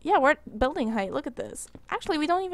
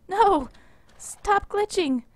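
frustrated; 7 semitones higher, slower than usual. Real, not acted. Game glitching, frustrated.

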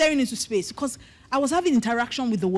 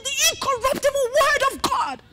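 much higher preacher's cry, fervent; imitating a preaching shout; 12 semitones higher than usual. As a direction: echo the pastor shouting from the pulpit. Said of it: "he was just shouting".

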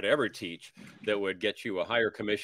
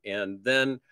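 normal talking voice

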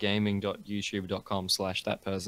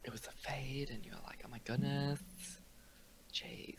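whisper; 4 semitones higher, 16 dB quieter than usual. Acted.

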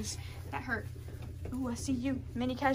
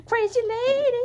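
louder and higher teasing cry; playful exclaim; 7 semitones higher, 8 dB louder than usual. Real, not acted. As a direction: call her out, loud and delighted. Exclaiming at the wild kitten.

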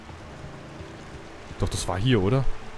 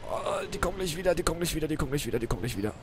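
anxious muttering; 5 semitones higher, 5 dB quieter than usual. Real, not acted. Enemies returning, anxious repeating.

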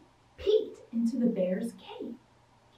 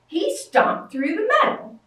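ordinary delivery, nothing marked

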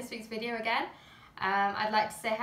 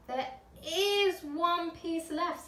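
put-on slow, higher, lightly helpful little girl; 7 semitones higher, much slower than usual. A narrator's reading. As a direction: point it out sweetly. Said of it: "said Laura in a helpful way".

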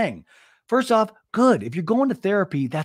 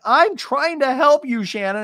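mocking Tamara; 9 semitones higher, 4 dB louder than usual. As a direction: plead with exaggerated patience. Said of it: "Tamara's like".

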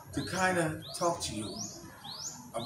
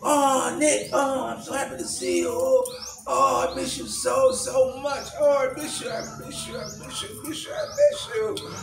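gushing, much higher and louder cries of joy; retold rapturous reunion, soaring high; wife greets husband home, ecstatic and high; overjoyed welcome; 9 semitones higher, 4 dB louder than usual. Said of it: "And she was happy to see him".

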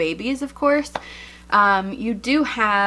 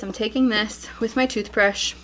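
ordinary talk

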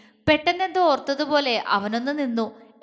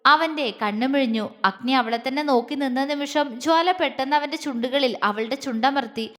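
usual speaking voice

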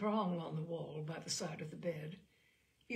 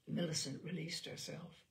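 ordinary delivery

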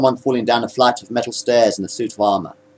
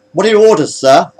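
high, louder pilot's query; sharp metallic voice; 8 semitones higher, 5 dB louder than usual. A narrator's reading. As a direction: ask for orders in a lightly metallic voice. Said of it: "asked the sharp metallic voice".

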